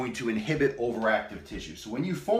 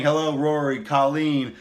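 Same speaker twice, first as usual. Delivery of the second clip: usual speaking voice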